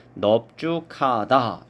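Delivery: 넓죽하다 as 넙쭈카다, syllable by syllable; pronunciation demo. Shown as a demonstration. Teacher showing students the ㅂ reading, 넓죽하다 spoken as 넙쭈카다. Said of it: "이렇게 발음하는 것이 맞습니다".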